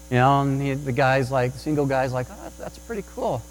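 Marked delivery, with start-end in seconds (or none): 2.3-3.4 soft, higher-pitched aside; quiet admiration. Retold or imitated. Single shopper privately admiring a patient dad, quiet admiration. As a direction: voice a bystander's hushed approval.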